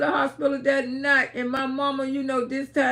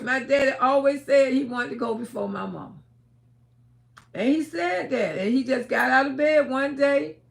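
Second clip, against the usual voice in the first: ordinary delivery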